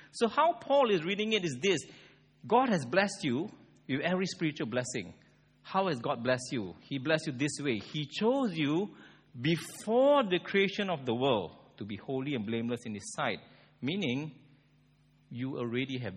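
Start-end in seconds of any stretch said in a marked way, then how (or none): none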